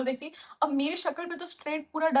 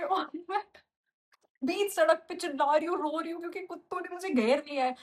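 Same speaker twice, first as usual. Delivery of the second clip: ordinary talk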